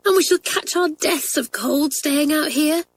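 put-on a scared girl breathing her worry; frightened whisper. A narrator's reading. Kathleen fretting to friends outside at night, timid and high. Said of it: "she whispered".

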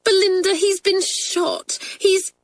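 very high, slow, tearful plea; almost in tears. A narrator's reading. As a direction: blurt the bad news near sobbing. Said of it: "almost in tears".

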